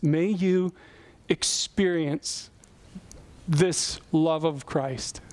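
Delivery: drawn-out, slow "experience" with a pause before it; slow emphasis on experience. Shown as a demonstration. Preacher urging the congregation to feel God's love, slow emphasis. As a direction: lean on "experience", slowly. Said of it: "underline the word experience".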